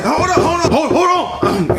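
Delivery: flustered laugh. Acted.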